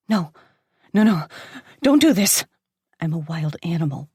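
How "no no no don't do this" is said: frantic no, no, louder and higher; terrified woman pleading with her attacker; desperate pleading. Acted. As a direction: beg him to stop, panicked. Pinned down, begging him to stop.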